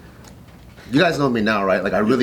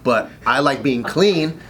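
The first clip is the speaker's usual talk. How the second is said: ordinary talk, nothing marked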